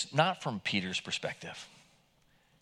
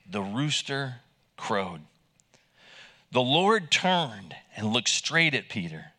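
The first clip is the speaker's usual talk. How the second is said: usual speaking voice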